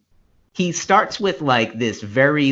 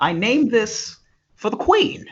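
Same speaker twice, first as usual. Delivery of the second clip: high-pitched royal proclamation; mock-grand colonial explorer; pompous colonizer; 10 semitones higher than usual. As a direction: claim the land for the crown. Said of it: "sounds like you're about to colonize something".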